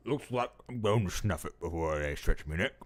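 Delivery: low, weak Cockney convict rasping; dying convict voice. Shown as a demonstration.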